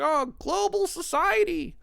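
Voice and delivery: high, slow sarcastic sneer; mock scoff. Mocking critics of global society, high sneer.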